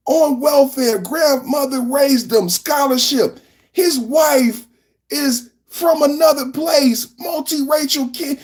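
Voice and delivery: high-pitched, fervent exasperation; worked-up, incredulous rant; impassioned plea. Real, not acted. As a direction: raise the voice and plead with conviction. Impassioned rant about America's divisions.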